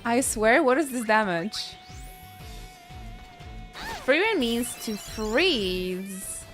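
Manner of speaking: ordinary talk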